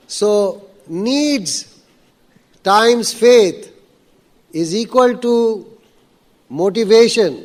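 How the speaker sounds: each word spaced out slowly; slow, deliberate teaching tone; slow dictation. Shown as a demonstration. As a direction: spell the formula out word by word, slowly.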